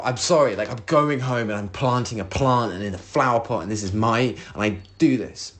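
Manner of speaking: ordinary delivery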